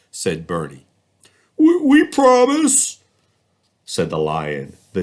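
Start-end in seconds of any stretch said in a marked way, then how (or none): 1.6-3.0 high, loud, slow stammered promise; animals promising. Acted. Animals promising Bernie to fix the toys, high and stammering. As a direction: promise eagerly to get free.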